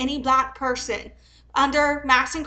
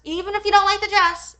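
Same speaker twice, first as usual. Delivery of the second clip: louder and higher, hammering the point; raised emphatic repeat; 6 semitones higher, 5 dB louder than usual. Real, not acted. Ranting at the seller over the dress, louder repeat. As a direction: push harder the second time.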